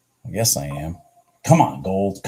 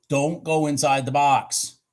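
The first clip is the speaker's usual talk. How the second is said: normal talking voice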